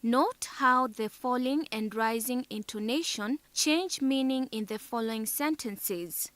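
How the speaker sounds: normal talking voice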